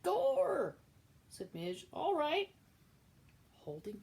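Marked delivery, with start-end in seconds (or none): 0.0-0.8 high, loud exclamation; maid's exclamation. Acted. Midge exclaiming at Cook, high loud maid voice. 1.9-2.5 maid's voice. Acted. Midge answers Cook.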